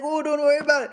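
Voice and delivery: imitating friend. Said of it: "And he's like".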